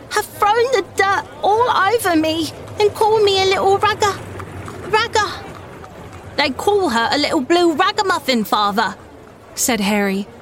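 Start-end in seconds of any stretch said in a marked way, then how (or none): 0.1-5.3 put-on a small girl crying out her hurt; sobbing. A narrator's reading. Little Dolly sobbing to her father about the boys. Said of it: "she sobbed". 6.4-9.0 put-on higher boyish voice, indignant; a boy bristling on his sister's behalf; angry boy. A narrator's reading. Harry angrily tells his father about the bullies. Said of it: "looking very angry".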